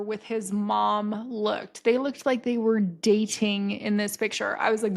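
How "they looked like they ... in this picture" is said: normal talking voice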